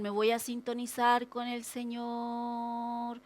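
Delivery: tune in to God with comic solemnity; parodia chistosa. Acted. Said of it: "Yo lo hago chistoso". (parodia mística, monótona y alargada)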